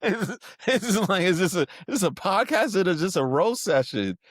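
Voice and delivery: high, loud, incredulous comic protest; mock indignation. Real, not acted. Guest jokingly protests the host's roasting intro, mock indignation.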